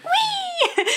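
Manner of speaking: very high squealed whee; mock gleeful whee. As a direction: squeal like riding a runaway bus, laughing. Joking noise of a crashing bus.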